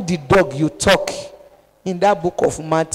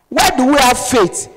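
ordinary delivery

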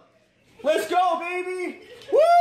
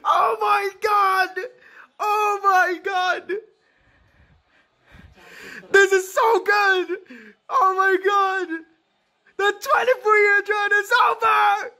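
tearful joyous shout; 4 semitones higher than usual. Real, not acted. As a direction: cry out in disbelief and relief. Fan cries out at championship.

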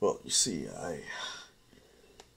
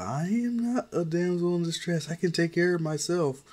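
put-on higher girl voice, firmly defiant; insisting she is no damsel, pitched up; defiant young girl; 9 semitones higher than usual. Melissa insisting.

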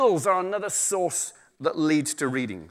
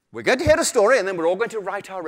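bright teacher voice; 4 semitones higher, 5 dB louder, faster than usual. Retold or imitated. Teacher voice mocked by storyteller.

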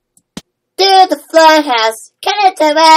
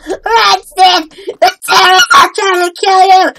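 high mock-panic warning yell; panicked shout; 3 semitones higher than usual. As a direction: shout a frantic warning to a friend. Warning Stan of attack in game.

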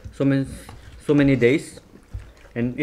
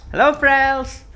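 high squeaky greeting; 11 semitones higher, 7 dB louder, much slower than usual. Pretend. Playful greeting to camera.

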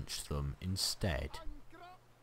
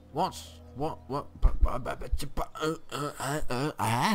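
high, stammering confusion; stunned disbelief; 6 semitones higher, 3 dB louder than usual. Real, not acted. Gamer hit by a surprise war, stammering disbelief. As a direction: splutter helplessly, too shocked for words.

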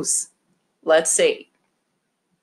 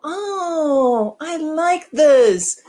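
excited squeal; 8 semitones higher, 3 dB louder, much slower than usual. Pretend. Teacher voices toy excitedly.

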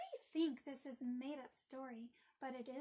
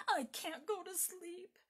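put-on higher, louder childish whine; upset little girl; 5 semitones higher, 5 dB louder than usual. A narrator's reading. Margaret to brother at bedtime, upset whine. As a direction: complain like a tearful child in bed. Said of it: "amid the tears and sobs".

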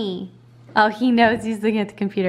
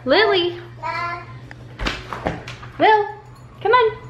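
high, bright call to the dog; 9 semitones higher than usual. Real, not acted. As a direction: call the dog over, pitch up. Calling the dog over.

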